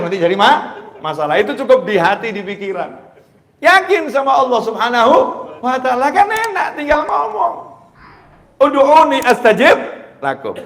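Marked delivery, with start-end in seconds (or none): 3.6-7.5 high, loud sermon parable; fervent storytelling. Real, not acted. Preacher telling the crowd the child-and-father parable, loud. 8.6-10.6 high, loud proclaimed quote; proclaimed verse. Shown as a demonstration. Preacher proclaiming the verse to the crowd, loud and high.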